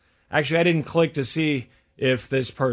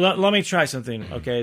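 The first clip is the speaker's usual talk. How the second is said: usual speaking voice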